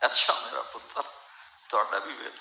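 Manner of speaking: soft, resigned, broken words; hurt old father. Retold or imitated. Father answering his son's taunt, soft and resigned. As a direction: say it softly, swallowing the hurt. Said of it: "اور آگے باپ کہہ رہا تھا".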